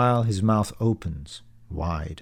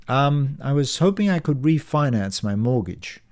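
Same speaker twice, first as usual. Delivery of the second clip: ordinary delivery, nothing marked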